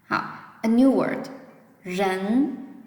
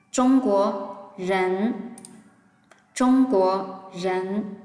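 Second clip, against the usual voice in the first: very slow, syllable by syllable; drawn out so students can copy; slow teacher demo; slow model reading; much slower than usual. Shown as a demonstration. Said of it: "Please try to read with me".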